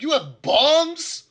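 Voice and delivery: high, loud, incredulous yelling; furious camp boss scolding at full volume; outraged shouting. Acted. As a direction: shout in disbelief, dragging out the key word. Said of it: "He's like".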